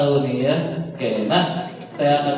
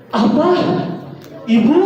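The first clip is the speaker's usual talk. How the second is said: much higher, louder startled cry; shocked child learning about the mother; 10 semitones higher, 4 dB louder than usual. Shown as a demonstration. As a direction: jolt as if hit by the news. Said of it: "Ketika kaget".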